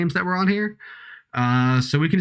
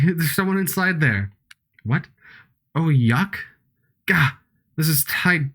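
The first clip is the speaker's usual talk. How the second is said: ordinary delivery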